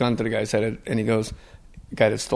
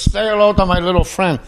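priest quoting Scarface; 8 semitones higher, 4 dB louder than usual. Retold or imitated. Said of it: "He goes".

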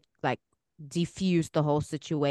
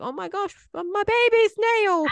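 put-on high, loud alarmed cry over the baby's nail; 6 dB louder than usual. Mother to hurt daughter, high alarmed cry. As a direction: panic rising at the sight of blood.

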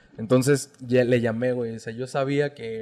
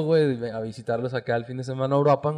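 normal talking voice

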